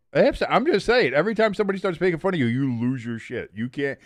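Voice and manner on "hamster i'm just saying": normal talking voice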